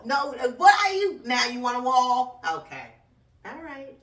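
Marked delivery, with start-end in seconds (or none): 0.0-2.4 loud scoffing outburst; mocking shout. Mocking Biden over the wall, a loud scoffing yell. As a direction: yell it back in disbelief.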